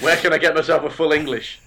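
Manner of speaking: high, loud tourist whinge; grumbling British tourist. Retold or imitated. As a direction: whine like a lad abroad.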